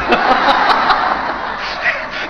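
high, loud burst of laughter; hearty laugh. Real, not acted. Laughs at his own story. (放声大笑)

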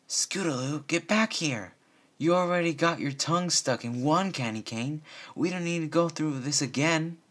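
high, loud call down the street. Acted. Bon Bon calling after Scootaloo, high and loud. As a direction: shout after a runaway filly. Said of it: "Bon Bon called down the street".

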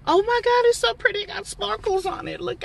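high, gushing over the pretty card; delighted squeal. Real, not acted.

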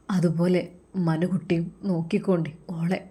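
confiding whisper to her son; a soft, private whisper. Acted. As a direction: whisper the advice close and secret. Mother's confiding advice to son.

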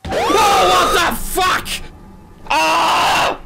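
high scared shout; terrified shout. Real, not acted. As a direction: yell out in real fright. Jump scare in game.

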